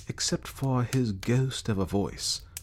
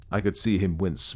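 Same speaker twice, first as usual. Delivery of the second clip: plain reading voice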